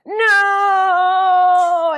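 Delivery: high, loud despairing cry of no; anguished no. Retold or imitated. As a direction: wail it like your work just got cancelled. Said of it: "And you're like".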